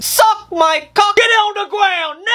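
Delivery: high shouted command; angry shout. Acted. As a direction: bark it at the suspect. Cop shouting at driver.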